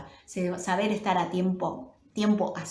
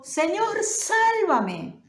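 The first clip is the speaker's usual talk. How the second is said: much higher, pleading call; desperate cry for help; 11 semitones higher, much slower than usual. Acted. Peter sinking in the wind, crying to his Lord in panic. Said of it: "Y entonces gritó".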